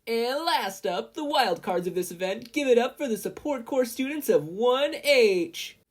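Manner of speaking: over-the-top arena host call; Present Mike. A narrator's reading. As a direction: belt it like a stadium announcer. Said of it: "announced Present Mike".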